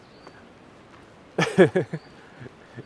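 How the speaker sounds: short amused laugh. Real, not acted. Vlogger laughs at a viewer's comment, amused laugh.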